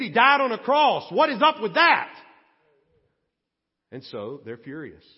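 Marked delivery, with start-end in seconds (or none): 0.0-2.1 indignant outburst. Acted. Preacher's indignant punchline.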